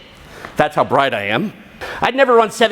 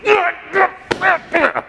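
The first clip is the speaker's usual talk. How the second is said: high, strained grunts of frustration; frustrated grunts; 9 semitones higher than usual. Retold or imitated. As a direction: grunt like you're fed up with yourself. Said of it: "And I'm furious".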